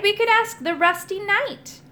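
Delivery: high, eager suggestion; keen exclamation; excited cry. A narrator's reading. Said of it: "I cried".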